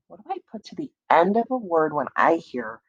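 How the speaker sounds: usual speaking voice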